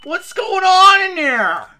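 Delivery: loud, high, slow call into the room; exasperated yell at the useless cook. Real, not acted. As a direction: shout through the door at him. Yelling at NPC cook.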